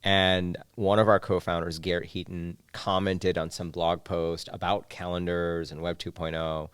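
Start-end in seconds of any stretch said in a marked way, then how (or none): none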